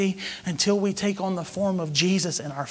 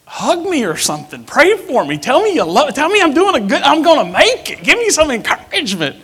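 rising, louder and higher plea for comfort; loud pleading; 8 semitones higher, 9 dB louder than usual. Retold or imitated. Pastor retelling how he begged his wife, loud climbing plea. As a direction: beg her loudly for encouragement, voice climbing.

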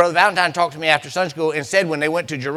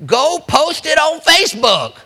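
much higher joking fake verse; mock scripture reading; 10 semitones higher, 3 dB louder than usual. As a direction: slip in a silly fake verse with a grin. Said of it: "That's not what it says".

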